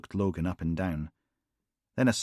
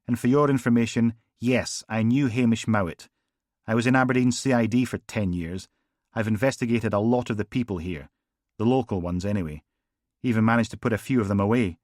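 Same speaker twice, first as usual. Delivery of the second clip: louder, fast, defensive sergeant; defensive Logan; 3 semitones higher, 5 dB louder than usual. Acted. Logan snapping back at his bosses, defensive. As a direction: rattle off his record with irritation.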